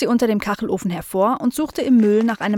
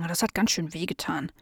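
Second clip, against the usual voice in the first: 1 pained; 3 semitones lower, 7 dB quieter than usual. Acted. Aunt holds her bumped head.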